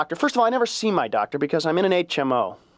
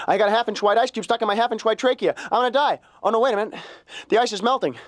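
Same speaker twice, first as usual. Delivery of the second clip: mock panic, much higher pitch; panicky thinking; 8 semitones higher than usual. Comic replaying his choking panic, hammed-up mild alarm. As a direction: rattle through the math in mild mock alarm. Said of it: "panicky math equation".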